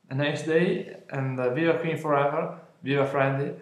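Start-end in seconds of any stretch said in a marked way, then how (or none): none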